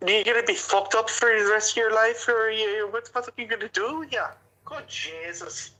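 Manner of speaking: put-on loud, drunken Irish rant; a shouting Irish uncle telling someone off; Irish accent. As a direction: boom it out in a thick Irish accent. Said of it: "I do the Irish accent".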